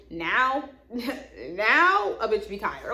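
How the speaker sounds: slow laughing drawl through giggles. Real, not acted. Laughing about being tired.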